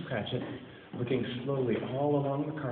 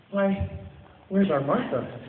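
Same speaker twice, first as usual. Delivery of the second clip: higher, louder hearty call; louder, higher glad father; cheerful cry; 9 semitones higher, 6 dB louder, slower than usual. A narrator's reading. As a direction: call out like a jolly father home. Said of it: "cried Bob Cratchit".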